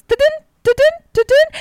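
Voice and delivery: high sung ta-dun chime; imitating a message chime; notification chime. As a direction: sing it like a phone alert tone. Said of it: "all you hear is".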